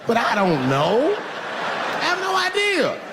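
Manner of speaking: slow, high-pitched confession; comic cluelessness. Acted. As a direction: climb high and drag out the admission. Confesses cluelessness about the projects.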